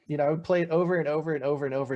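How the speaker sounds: normal talking voice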